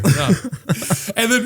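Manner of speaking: ordinary talk, nothing marked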